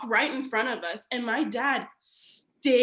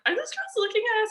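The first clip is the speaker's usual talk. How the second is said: mock sister; 11 semitones higher, much faster than usual. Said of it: "my sister's like".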